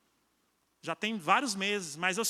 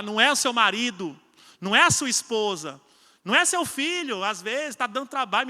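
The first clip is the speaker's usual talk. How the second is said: louder, higher exhortation building; fervent preaching; 3 semitones higher, 4 dB louder than usual. Acted. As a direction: drive the call home to the church. Pregação em força. (pregação fervorosa e crescente)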